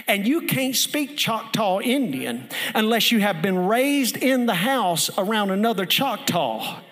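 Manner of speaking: loud, rising revival preaching; fiery pastor preaching the story's payoff; preaching at full force. Acted. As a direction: build it up to a shouting climax.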